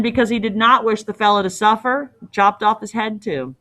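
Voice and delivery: usual speaking voice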